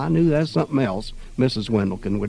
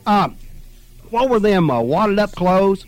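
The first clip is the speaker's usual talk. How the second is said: put-on higher, louder, slow confused boy; curious kid; 7 semitones higher, 5 dB louder than usual. A narrator's reading. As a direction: puzzle it out like a backwoods kid. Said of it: "Claude wanted to know".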